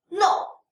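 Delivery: short, high "No" plunging down; fourth tone. Shown as a demonstration. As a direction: stop someone with a sharply falling "No". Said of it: "this is how the fourth tone should pronounce".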